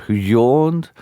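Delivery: ordinary delivery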